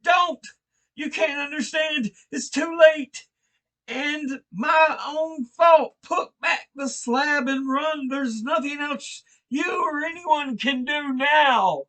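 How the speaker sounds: high, loud scream of utter despair; high despairing shout from below. Acted. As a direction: scream it, knowing it's hopeless. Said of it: "changed to a scream of utter despair".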